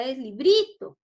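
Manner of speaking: "librito" with the middle BRI stretched; stress on BRI. Shown as a demonstration. Teacher correcting for pupils, stress on BRI in "librito". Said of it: "En la bri".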